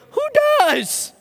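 very high put-on voice, punchy; imitating Riz. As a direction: mimic a friend's clipped slang. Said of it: "he would say something to the effect of".

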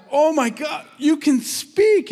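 high-pitched acted astonishment; amazed exclamation. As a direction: exclaim as a friend stunned by a miracle. Friends amazed their friend can speak.

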